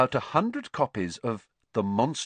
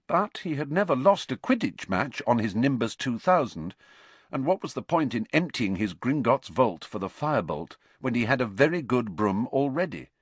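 usual speaking voice

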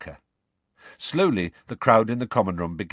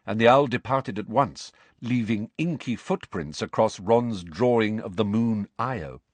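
usual speaking voice

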